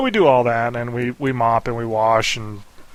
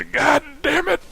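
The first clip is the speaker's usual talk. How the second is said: much higher, imitated angry outburst; dad's exasperated curse; slower than usual. Dad losing it over the dog, exasperated curse. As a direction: blurt it like a fed-up father.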